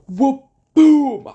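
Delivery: loud high cartoon whoop and boom; whoop boom sound effect. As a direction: belt out the slap noises high and loud. Imitating friend's slap on neck.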